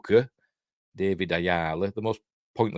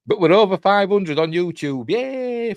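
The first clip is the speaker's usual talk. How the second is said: excited cheer; 9 semitones higher, 5 dB louder than usual. Real, not acted. Cheering subscriber count.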